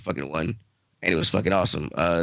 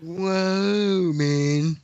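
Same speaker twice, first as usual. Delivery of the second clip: stoner; 7 semitones higher, much slower than usual. Real, not acted. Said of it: "I'm high as shit right now".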